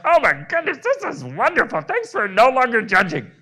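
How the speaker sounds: the body as a thrilled giddy little voice; body's delighted voice. Pretend. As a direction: voice the body squealing with joy.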